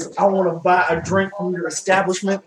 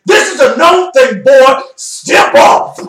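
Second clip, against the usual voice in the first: high, loud mock-threat yell, slower; gnome challenge shout; 7 semitones higher, 8 dB louder, much slower than usual. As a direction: bark it out like a tiny tough guy.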